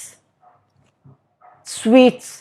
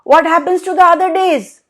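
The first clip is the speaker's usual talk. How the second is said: curious; 11 semitones higher, faster than usual. Acted. Gods question Ganesha.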